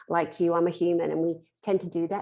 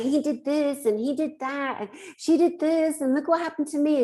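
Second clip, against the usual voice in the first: high whiny complaining; mocking her old whiny self; moaning Minnie; 8 semitones higher than usual. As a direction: whine it like a self-pitying moaner. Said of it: "I was such a moaning Minnie".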